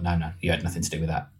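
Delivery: low, soft, quick brush-off; dismissive shutdown. Retold or imitated. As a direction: shut them down quickly under your breath. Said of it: "did it in that tone of voice".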